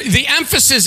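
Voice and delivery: ordinary talk, nothing marked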